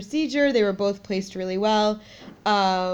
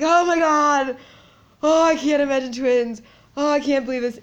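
overwhelmed disbelief; 6 semitones higher, 5 dB louder than usual. Retold or imitated. Freaking out about twins.

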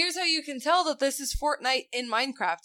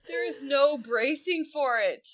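usual speaking voice

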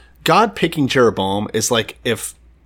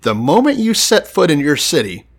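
normal talking voice

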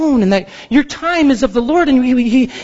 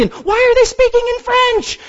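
exasperated. Retold or imitated. Frantic caller on phone menu.

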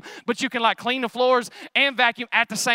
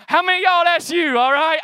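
shouting out; 3 semitones higher, 5 dB louder than usual. Real, not acted. Preacher calling out to crowd.

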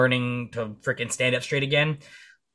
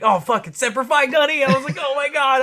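much higher and louder, squeaky mock panic; panicking; 13 semitones higher, 6 dB louder than usual. Caught trespassing, squeaking in mock panic. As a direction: yelp like you just got caught. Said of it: "I was freaking the fuck out".

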